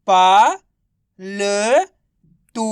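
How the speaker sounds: long pauses between पा, ल and तू; syllable by syllable. Shown as a demonstration. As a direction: sound out पा, ल, तू separately. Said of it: "तो मिलके बन जाएगा". (पा, ल, तू को अलग-अलग खींचकर)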